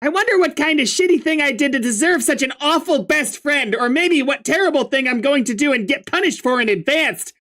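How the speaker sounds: sarcastic grumpy troll. Acted. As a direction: spit sarcasm, voice pitched high.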